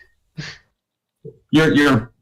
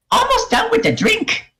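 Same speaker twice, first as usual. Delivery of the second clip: goblin voice. Acted. Goblin bartender speaking.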